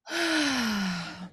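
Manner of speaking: long, soft exhale; weary sigh. Real, not acted. Tired host after recounting a bad day, sighing. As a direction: let out a drawn-out, quiet sigh.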